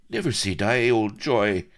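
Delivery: loud, high rallying cry; moved cry. Acted. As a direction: urge your dying friend to hold on. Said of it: "cried the colonel, moved".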